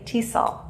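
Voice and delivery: "T sol" said as one word, TEE-sol; acronym as a word. Shown as a demonstration. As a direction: run "T sol" together as a single word. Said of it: "Letters that are pronounced as a word".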